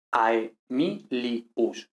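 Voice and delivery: AEMILIUS very slowly as ae-mi-li-us; diphthong, syllable by syllable. Shown as a demonstration. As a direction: break "Aemilius" into beats, keeping AE as one. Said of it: "Exactamente así los pronunciaríamos".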